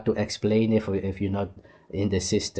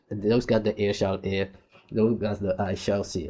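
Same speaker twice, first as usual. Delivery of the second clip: ordinary delivery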